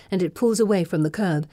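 ordinary delivery, nothing marked

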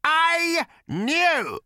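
very high, slow hateful scream; feverish shriek. A narrator's reading. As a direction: scream out years of hatred. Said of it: "a feverish pitch of hatred".

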